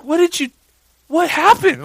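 very high, loud alarmed question; retold shock. Dad seeing the gash on his daughter, retold shocked outcry. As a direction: cry out in comic alarm.

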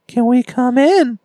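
put-on creepy child voice. Creepy children asking to enter.

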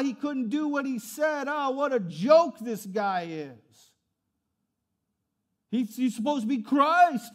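loud, high, slow mocking shout; jeering crowd. Crowd shouting insults at Jesus on the cross, loud jeering. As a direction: jeer and laugh him to scorn.

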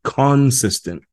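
"consistent" drawn out slowly, each syllable held; slow emphasis. Shown as a demonstration. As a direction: stretch "consistent" out slowly to drive it home. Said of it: "as [name] would say".

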